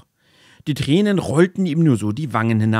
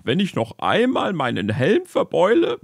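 sobbing soldier; 6 semitones higher than usual. Acted. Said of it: "schluchzte der Soldat".

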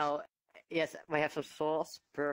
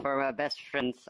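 ordinary talk, nothing marked

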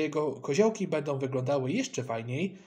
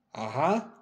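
ordinary delivery

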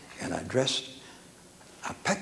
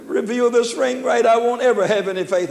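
upset Australian man; 8 semitones higher, 5 dB louder than usual. Retold or imitated. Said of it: "with tears running down his face".

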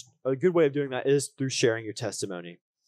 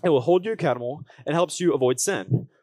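upbeat youth pastor; 5 dB louder than usual. Acted. Preaching to the congregation.

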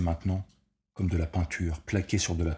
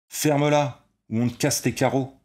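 loud, higher snarled bark; barked threat; 7 semitones higher, 6 dB louder than usual. A narrator's reading. Redhead threatening the old man, loud barked threat. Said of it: "aboya le rouquin".

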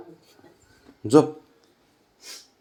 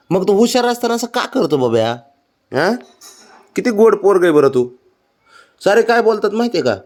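sing-song sweet talk to a pet; 8 semitones higher than usual. Real, not acted. Cooing at pet to wake it.

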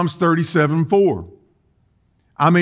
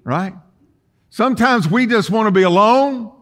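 normal talking voice

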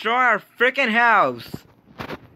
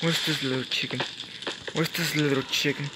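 much softer, lower stalking voice; sneaky hunting; 6 semitones lower, 11 dB quieter than usual. Real, not acted. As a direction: creep up on him quietly.

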